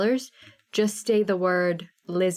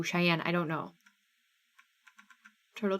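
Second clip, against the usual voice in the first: normal talking voice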